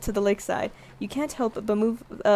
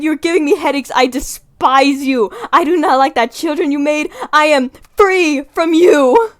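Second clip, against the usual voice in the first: passionate angry rant; 5 semitones higher, 8 dB louder than usual. Acted. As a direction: scream the insults at the parasite. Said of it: "I got a little too passionate".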